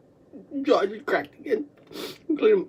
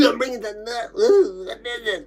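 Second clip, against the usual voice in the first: choking. Real, not acted. Choking up phlegm.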